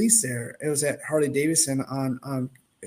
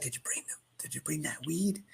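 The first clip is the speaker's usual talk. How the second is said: hushed, unvoiced whisper, much softer; secret whisper; 13 dB quieter than usual. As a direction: whisper like nobody must overhear. Dad secretly asking about weed.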